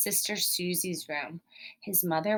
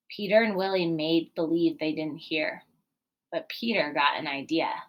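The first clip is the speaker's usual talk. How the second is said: ordinary delivery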